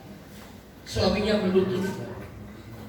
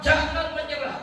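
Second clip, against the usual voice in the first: raised-pitch rallying plea; urgent retold encouragement; 6 semitones higher than usual. Preacher to a husband facing divorce, urging. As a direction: urge him on, imitating your own exhortation.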